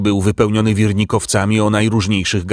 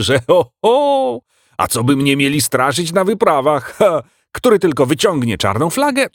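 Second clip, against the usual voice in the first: high-pitched creature voice, bragging; puffed-up, high-voiced little admiral; excitable animal admiral, boastful; 8 semitones higher than usual. A narrator's reading. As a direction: brag high and lively like a little sea-dog critter.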